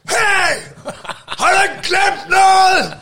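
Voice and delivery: very high, loud, drawn-out yell; retold confronting shout. Neighbour confronts dog owner who ignores him, shouting. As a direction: yell it accusingly down the street. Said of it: "Og så råber jeg bare".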